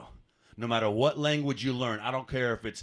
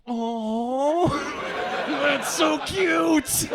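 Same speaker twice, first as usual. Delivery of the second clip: very high, drawn-out gushing coo; hammed-up high-pitched "aww, how cute"; mock cooing; 11 semitones higher, much slower than usual. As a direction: coo condescendingly as if at a toddler. Germans acted cooing at his German.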